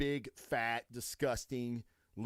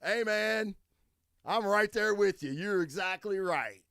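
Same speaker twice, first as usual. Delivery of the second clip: approving stranger; 7 semitones higher, 5 dB louder than usual. Retold or imitated. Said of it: "And he goes".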